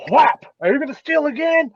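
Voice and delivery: high-pitched, hammed-up punisher; mock interrogation. Co-host pretending to wallop a thief, mock angry scolding. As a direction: smack sound, then squeaky scolding question. Joking act of beating thief.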